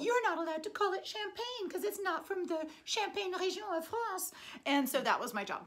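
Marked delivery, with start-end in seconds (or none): 0.0-4.3 stickler voice. Retold or imitated. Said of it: "letters saying".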